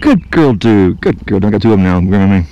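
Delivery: loud, fast delighted praise; thrilled, both cats grooming you; loud happy praise while two cheetahs groom him; excited. Real, not acted.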